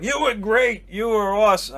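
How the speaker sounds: put-on high, loud drunken gushing praise; hammed-up loud tipsy compliments; wasted pizza owner. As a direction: imitate a boozy owner gushing loudly. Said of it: "He was just wasted".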